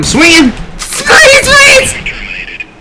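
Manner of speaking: frantic high yell while being chased; yelling in panic as he swings; panicked shout. Real, not acted.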